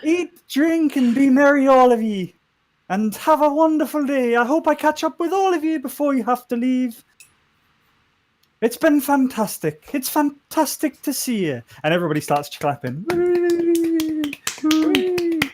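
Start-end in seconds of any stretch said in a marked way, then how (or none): 0.0-11.6 high, loud Geordie toast; hearty welcome in a lilting, drifting Geordie; put-on Geordie accent. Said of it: "She turned into a Geordie". 13.1-15.4 high squealed cheering noise; thin, high-pitched mock crowd whooping; imitated crowd cheer.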